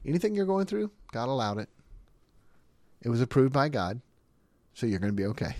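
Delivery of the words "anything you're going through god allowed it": ordinary talk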